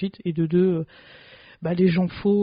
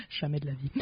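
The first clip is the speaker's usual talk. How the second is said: ordinary delivery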